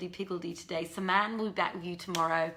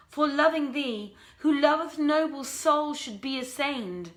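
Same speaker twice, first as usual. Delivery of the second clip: slow, higher, louder lovesick verse; poem recital; 6 semitones higher, 6 dB louder, slower than usual. Acted. Prince's love poem to his lady, slow impassioned recital. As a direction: declaim the couplets slowly like a pleading lover.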